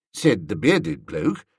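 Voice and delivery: ordinary delivery, nothing marked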